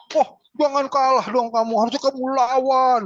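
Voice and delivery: high, loud, scolding parent; pushy scolding. Shown as a demonstration. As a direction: snap at the child to fight back. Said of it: "yang kayak gitu tuh menyakitkan".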